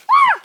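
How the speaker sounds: high, loud yelp of sudden pain; sharp pain yelp. Real, not acted. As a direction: yelp as a thistle pricks. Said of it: "I stood on a thistle".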